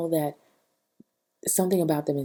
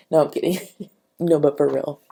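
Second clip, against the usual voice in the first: laughing, kidding; 7 dB louder, faster than usual. Real, not acted. Laughing at her own joke.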